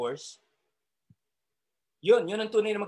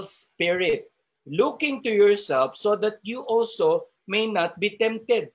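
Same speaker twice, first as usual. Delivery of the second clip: louder, preaching the verse with weight; scripture reading; 4 dB louder than usual. Acted. Preacher reading the verse to the brothers, forceful. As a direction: proclaim it to the congregation.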